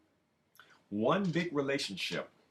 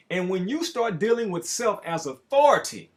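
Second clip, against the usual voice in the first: loud, rising sermon delivery; full-force preaching; 9 semitones higher, 10 dB louder than usual. Acted. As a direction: proclaim it like a preacher at the pulpit.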